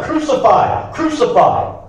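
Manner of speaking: crowd shouting. A narrator's reading. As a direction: call it out like an angry mob. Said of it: "they shouted".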